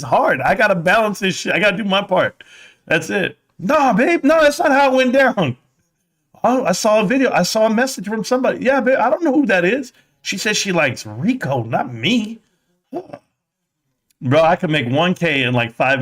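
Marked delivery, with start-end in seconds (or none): 3.6-5.6 high, loud mock denial; denying husband. Husband denying to wife, high and loud. 6.4-8.6 suspicious wife. Retold or imitated. 8.6-12.4 evasive husband. Retold or imitated.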